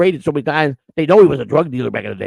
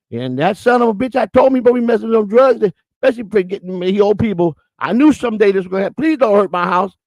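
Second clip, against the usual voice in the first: put-on high Southern old-lady voice, running on and on; rattling-off southern lady; 6 semitones higher than usual. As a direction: imitate a Southern mother venting, then pleading. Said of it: "she's just rattling off".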